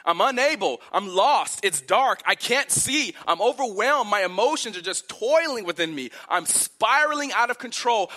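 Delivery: loud, high, pleading cry to God; desperate cry for help. Acted. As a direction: cry out to the Lord as if lost in the dark. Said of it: "he here cries out to God for help".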